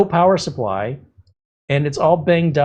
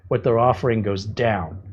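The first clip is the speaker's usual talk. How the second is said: usual speaking voice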